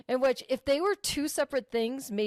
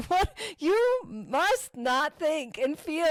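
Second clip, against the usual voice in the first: ordinary delivery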